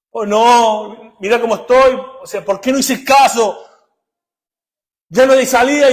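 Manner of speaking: loud, high mock wailing; acted lament. Preacher voices the damned regretting in hell, loud lament. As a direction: cry out in hammed-up despair and regret.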